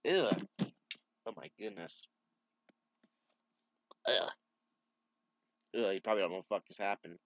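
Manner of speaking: coughing, disgusted after a bug; gagging on a swallowed bug; just swallowed a bug. Real, not acted. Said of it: "almost swallowed a bug".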